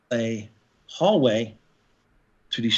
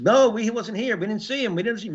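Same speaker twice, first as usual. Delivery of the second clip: mock hospital staff denial; 9 semitones higher than usual. Hospital staff denying, voiced by teller.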